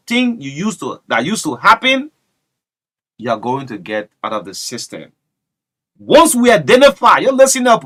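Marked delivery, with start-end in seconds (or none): 6.0-7.8 loud, high presidential warning; booming imitation of the president; Joseph Boyka voice. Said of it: "in the Joseph Boyka voice".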